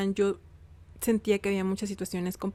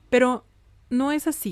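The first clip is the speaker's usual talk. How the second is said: louder, higher "Pero" cutting in hard; emphatic denial; 4 semitones higher, 6 dB louder than usual. Real, not acted. Telling viewers her father always helped her, with firm emphasis. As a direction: push back firmly on "no es así".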